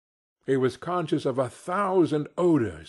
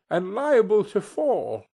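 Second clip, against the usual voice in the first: higher, pleading protest; remonstrating; 8 semitones higher, 4 dB louder than usual. A narrator's reading. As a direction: object nervously to the spirit. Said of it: "Scrooge remonstrated".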